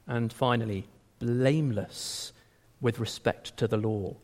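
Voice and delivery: ordinary delivery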